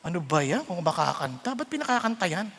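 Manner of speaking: low, quiet complaint; soft, low-pitched annoyed aside; disapproving grumble. Acted. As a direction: grumble under your voice about a bad singer. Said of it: "kung hindi mo anak yun".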